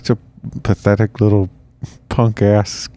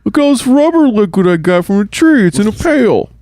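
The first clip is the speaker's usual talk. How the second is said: high goofy voice; 12 semitones higher than usual. Acted. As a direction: play a proud dim explorer. Mocking dumb colonist voice.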